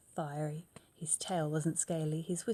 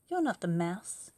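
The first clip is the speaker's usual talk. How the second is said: loud; 7 dB louder than usual. Acted. Child confronts owl.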